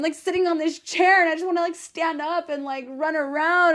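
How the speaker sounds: high, loud, bursting with passion; near-screaming excitement. Real, not acted. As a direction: let the excitement spill over, almost yelling. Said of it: "sometimes I feel like I'm screaming".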